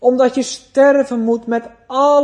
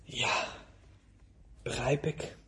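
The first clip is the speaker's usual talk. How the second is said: whispered, much softer agreement; meek whisper; 8 semitones lower, 17 dB quieter than usual. Retold or imitated. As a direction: breathe it, barely voiced, resigned. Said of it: "U zegt". (gefluisterd, heel zacht instemmen)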